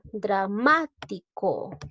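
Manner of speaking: "Dramático" at half speed, MÁ punched; slow teacher's "Dramático", stressed second syllable. Shown as a demonstration. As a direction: break "Dramático" up slowly, stressing MÁ. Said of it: "fuerza de voz en la sílaba ma".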